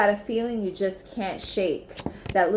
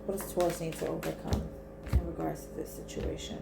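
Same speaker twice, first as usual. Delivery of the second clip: soft, low murmured question; quiet murmur; 4 semitones lower, 9 dB quieter than usual. Real, not acted. Reader asking the cards about the situation, murmured low.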